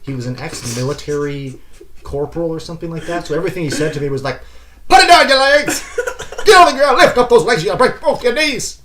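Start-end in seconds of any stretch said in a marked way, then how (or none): 4.9-8.8 high, loud yelling drill sergeant. Retold or imitated. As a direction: bark orders like a drill sergeant. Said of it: "like, my drill sergeant".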